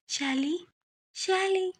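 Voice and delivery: put-on a ghost luring the girl, hushed; faint spectral whisper-call; ghostly calling voice. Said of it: "ये आवाज़ कैसी?".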